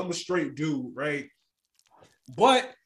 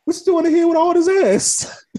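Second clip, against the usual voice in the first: catcaller voice; 10 semitones higher, 6 dB louder than usual. Retold or imitated. Acted catcaller in gym.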